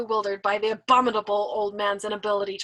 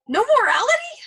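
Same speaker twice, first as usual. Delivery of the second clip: higher, louder indignant question; indignant young man; 9 dB louder than usual. Acted. Nately challenging the old man, high indignant pitch. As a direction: demand it in disbelief.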